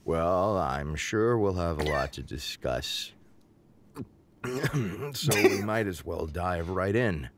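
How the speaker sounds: slow, low talk-show host. Acted. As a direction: hold it low and awkward. [name] as host, shaky.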